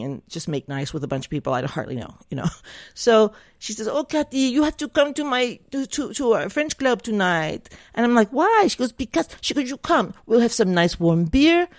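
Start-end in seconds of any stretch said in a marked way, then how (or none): 3.9-7.7 put-on higher-pitched French-accented pleading; playful French accent, pitched up; French lady. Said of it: "She was a French lady". 8.3-8.7 high-pitched retold "why?"; protesting why. Retelling her own reluctant reply. 8.9-9.3 put-on higher-pitched French-accented pleading; playful French accent, pitched up; French lady. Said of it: "She was a French lady". 9.7-11.7 put-on coaxing in a higher French accent; lightly hammed French accent; French lady. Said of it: "She was a French lady".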